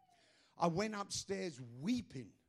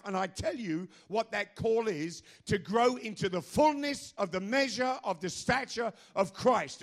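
rising sermon voice; preaching; 4 semitones higher, 9 dB louder than usual. Acted. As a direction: build the message toward the crowd. Sermon building up.

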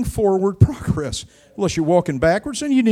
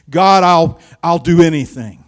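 loud confession; 2 semitones lower, 8 dB louder than usual. Retold or imitated. Congregation's confession of dedication.

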